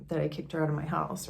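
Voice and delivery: ordinary delivery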